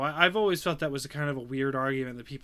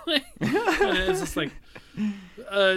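laughing; 13 semitones higher, 5 dB louder, slower than usual. Real, not acted. Laughing at dark game.

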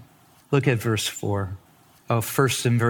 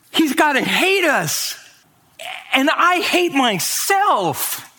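ashamed brothers; 13 semitones higher than usual. Acted. The brothers in guilt before Joseph, high anguished voice. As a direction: cry out their guilt as the brothers.

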